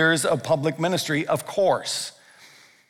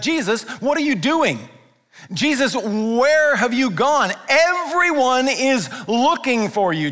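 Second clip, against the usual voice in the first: high, exasperated, drawn-out complaint; irritated Peter; 7 semitones higher than usual. Retold or imitated. Peter irritated at Jesus hiding out of town, high and exasperated. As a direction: whine at him like a fed-up friend. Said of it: "you can almost hear the irritation in Peter's voice".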